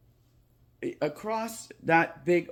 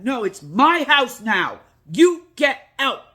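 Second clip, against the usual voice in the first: much higher and louder, slow and forceful; loud insistent shout; 11 semitones higher, 12 dB louder, slower than usual. Retold or imitated. Boy shouting the ghost out a second time, loud and slow. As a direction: yell it back at the ghost. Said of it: "I said again".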